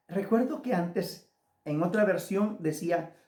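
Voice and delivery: normal talking voice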